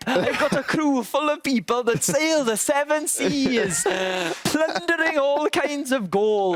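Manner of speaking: high, slow hammy pirate; a theatrical pirate captain boasting. Pretend. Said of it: "you can say a pirate".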